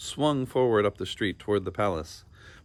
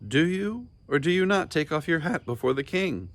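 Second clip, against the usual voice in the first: higher, stern question, leaning on not; stern demand; 8 semitones higher than usual. A narrator's reading. King questioning Bartholomew about his hat, stern and higher. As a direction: interrogate him like a judge. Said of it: "demanded the king".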